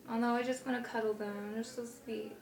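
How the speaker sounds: very soft little aside; hushed, adoring murmur; tender cooing. Real, not acted. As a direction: coo softly, as over something cute. Cooing over cute dolls.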